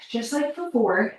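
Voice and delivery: ordinary talk, nothing marked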